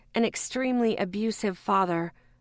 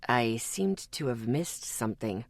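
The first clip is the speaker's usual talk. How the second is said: calm man voice; 8 semitones lower, 5 dB quieter than usual. A narrator's reading. Ethan arrives, calm.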